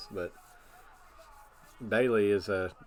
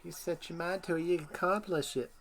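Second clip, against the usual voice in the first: mock Southern Belle voice; 7 semitones higher, 4 dB quieter than usual. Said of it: "Got that Southern Belle twang to her".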